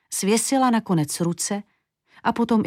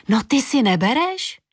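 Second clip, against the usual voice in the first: exclaiming; 5 semitones higher than usual. A narrator's reading. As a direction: call out in dismay. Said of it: "vykřiklo zrcadlo".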